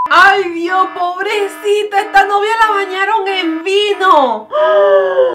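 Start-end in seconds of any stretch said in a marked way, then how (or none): 4.5-5.3 high pained cry of shock. Real, not acted. Vlogger seeing bride drenched in wine, shocked cry. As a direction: cry out in dismay.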